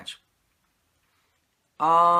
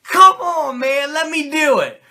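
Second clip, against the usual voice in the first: loud, high, pleading whine; hyped-up whiny plea for the tag; begging to be tagged in; 9 semitones higher, 9 dB louder than usual. Retold or imitated. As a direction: beg your partner to let you in. Kid begging Razor for tag.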